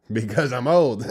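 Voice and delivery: loud, high mock protest; hammed-up indignant outburst, imitating him; mimicked old man. Reactor mocking the old driver's excuse, loud mimicked protest.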